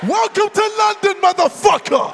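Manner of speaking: loud trash talk yelling; furious shout. Real, not acted. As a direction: roar it at your rival.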